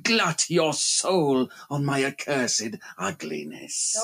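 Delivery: loom over her and gloat slowly; menacing phantom voice. Acted. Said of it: "he cried".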